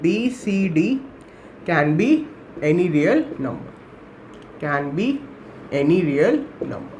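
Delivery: low, drawn-out words with pauses for writing; slow dictation. Real, not acted. Teacher dictating the rule to students as they write, slow dictation. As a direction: dictate it slowly, piece by piece.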